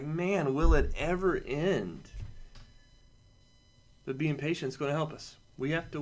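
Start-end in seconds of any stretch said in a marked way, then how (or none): 0.0-2.1 slow, drawn-out groan of impatience; weary sigh. Retold or imitated. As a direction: sag into the words, fed up with waiting. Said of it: "And you're like".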